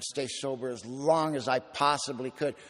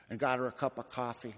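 ordinary delivery

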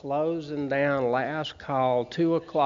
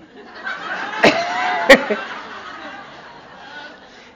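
long laugh; 11 semitones higher, 4 dB louder than usual. Real, not acted. Laughs at his own story.

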